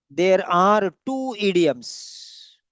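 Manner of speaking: "There are two idioms" drawn out very slowly; slow, emphatic repetition. Shown as a demonstration. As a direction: spell it out word by word for the class, slowly. Teacher repeats slowly for students.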